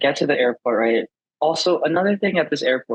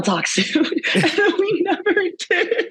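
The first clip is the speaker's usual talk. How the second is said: laughing; slower than usual. Real, not acted. Laughs while telling story.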